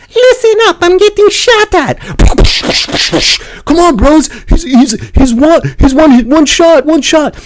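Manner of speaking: high, loud panicked squad callout; mock a teammate screaming for backup; frantic gamer callout. Streamer mimics panicked teammate, high frantic yelling. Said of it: "So imagine this".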